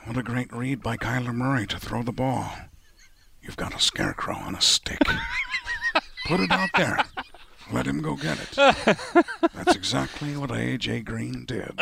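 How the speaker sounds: low Liam Neeson menace; Liam Neeson impression. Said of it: "can you do it as Liam Neeson".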